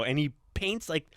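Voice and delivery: ordinary talk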